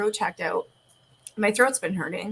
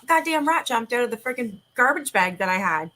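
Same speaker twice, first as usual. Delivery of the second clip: normal talking voice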